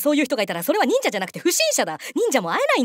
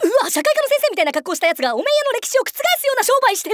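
high, breakneck-comic exclamation; loud-leaning straight-man shock; shocked disbelief; 9 semitones higher, 4 dB louder than usual. Acted. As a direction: cry out in astonishment at the sight. Shocked reaction to mask seller.

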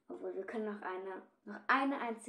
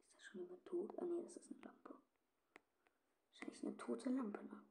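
soft voiceless whisper; sneaking whisper; 9 dB quieter than usual. Real, not acted. Player creeping through the map, whispering. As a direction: whisper like you're creeping through a dark house.

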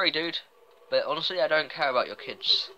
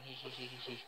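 very soft tittering hee-hee; sly giggle; 17 dB quieter than usual. Pretend. Player who secretly knows exactly what they're doing, soft giggle. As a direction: titter quietly to yourself.

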